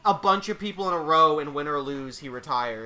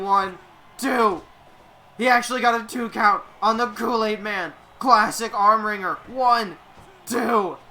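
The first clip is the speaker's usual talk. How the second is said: wrestling commentator; 4 semitones higher, 4 dB louder than usual. Acted. Play-by-play wrestling call.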